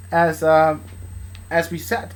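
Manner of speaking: usual speaking voice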